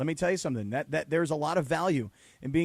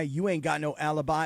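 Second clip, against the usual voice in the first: ordinary talk, nothing marked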